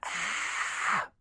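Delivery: soft whispered "ah". Shown as a demonstration. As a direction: breathe the "ah" out unvoiced. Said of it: "we're getting that".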